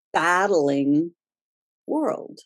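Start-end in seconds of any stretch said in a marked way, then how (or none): none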